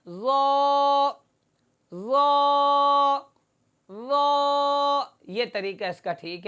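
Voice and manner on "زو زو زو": زو sung out long and full from an open mouth. Shown as a demonstration. Quran teacher demonstrating زو with mouth wide open. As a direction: fill the whole mouth with the sound of زو. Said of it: "منہ کھول کر آواز پورے منہ میں پھیلا کر پڑھنا".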